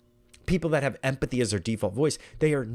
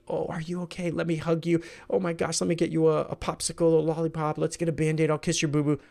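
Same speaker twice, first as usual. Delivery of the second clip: fussing parent; 5 semitones higher than usual. Retold or imitated. Voicing empathetic parent to hurt child.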